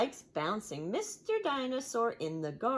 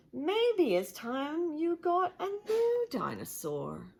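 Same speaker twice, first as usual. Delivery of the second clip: Daddy Pig voice; 9 semitones higher than usual. Acted.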